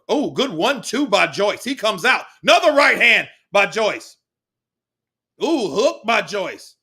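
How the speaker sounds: excited shout. Real, not acted. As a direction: bark out each shot.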